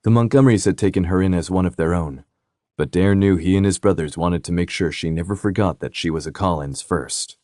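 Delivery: ordinary delivery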